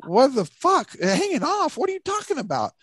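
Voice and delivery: high-pitched, incredulous mock outburst; retold disbelief. Storyteller replaying his reply to his friend, incredulous. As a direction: replay your baffled retort way up high. Acting out his own baffled retort.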